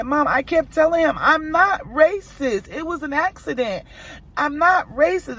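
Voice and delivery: put-on high, pleading little boy protesting; son's pleading voice. Mother imitating her son pleading with the angry man, high and pleading. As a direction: mimic a scared boy insisting he's innocent. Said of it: "I hear it in my baby voice".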